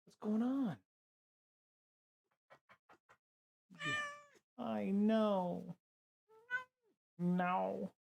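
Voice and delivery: high, soft sing-song pet talk. Real, not acted. Talking to pet again.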